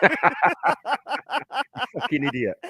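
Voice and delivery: slow, scornful laugh into a yelled insult. Real, not acted. Streamer laughing scornfully at the man who challenged him.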